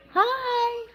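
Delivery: very high, squeaky greeting. Acted. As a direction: pop the hi up into a tiny cartoon squeak. Squeaky greeting to a stream viewer.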